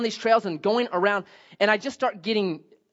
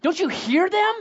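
higher, incredulous wife voice; mock exasperated wife; wife's exasperated question; 8 semitones higher than usual. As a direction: play the fed-up wife, pitched up. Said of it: "she's like".

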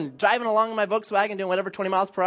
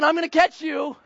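higher, hammed-up determined shout; mock chase threat; 7 semitones higher than usual. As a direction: play the pursuer for laughs. Flooring the Volkswagen in pursuit.

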